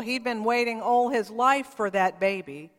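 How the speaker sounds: usual speaking voice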